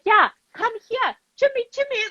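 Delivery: high sing-song calling the dog; high coaxing call. Retold or imitated. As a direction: coax the dog over in a high voice. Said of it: "She's like".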